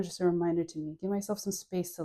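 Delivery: ordinary talk, nothing marked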